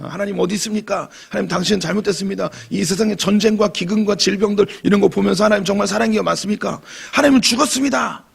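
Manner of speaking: mock atheist. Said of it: "무신론자들이 이야기하는 것".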